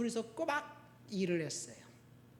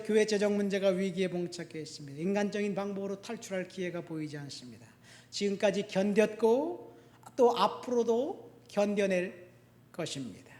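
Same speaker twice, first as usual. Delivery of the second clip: ordinary talk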